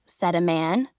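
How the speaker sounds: plain reading voice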